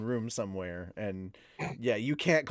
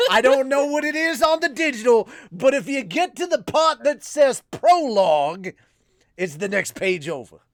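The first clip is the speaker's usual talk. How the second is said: screaming preacher; 8 semitones higher, 7 dB louder than usual. Acted. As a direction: bellow it like a fired-up reverend. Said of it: "at least you're not screaming".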